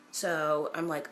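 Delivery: usual speaking voice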